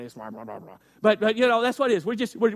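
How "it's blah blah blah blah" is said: low, barely audible grumble; hushed mock muttering of nonsense syllables; murmuring. Shown as a demonstration. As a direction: grumble it under your breath. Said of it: "word translated murmur is similar to that".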